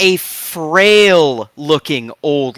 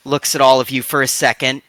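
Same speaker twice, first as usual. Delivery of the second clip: ordinary delivery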